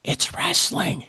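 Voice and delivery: slow stage whisper; confidential whisper. Retold or imitated. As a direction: lean in close and whisper it like a secret. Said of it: "I lean in real close".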